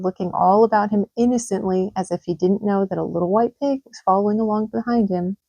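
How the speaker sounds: plain reading voice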